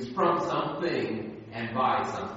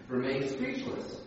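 ordinary talk

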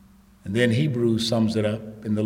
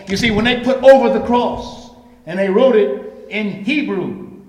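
raised, driving voice with pressing questions; fervent preaching; 11 semitones higher, 10 dB louder than usual. Real, not acted. Preacher explaining the sign on the cross, fervent preaching.

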